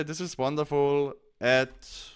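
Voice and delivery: ordinary talk, nothing marked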